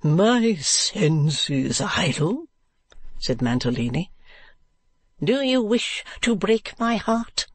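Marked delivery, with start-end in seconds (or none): 0.0-2.5 very slow, stretched endearment; Mr. Mantalini. Acted. Husband placating his outraged wife, very slow drawl. 5.2-7.5 high, slow reproach; Madame Mantalini. Acted. Wife reproaching her flirting husband, high and slow.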